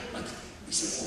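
ordinary talk

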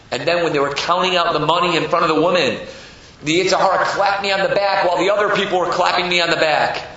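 ordinary delivery, nothing marked

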